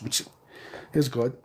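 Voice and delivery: normal talking voice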